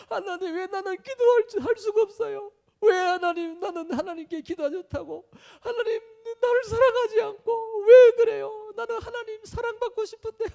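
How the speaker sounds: loud, very high mock-crying plea; hammed-up sobbing prayer at full cry; tearful resentful wail. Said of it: "원망하면서 막 분통을 터뜨리면서, 막 눈물이 막 나는".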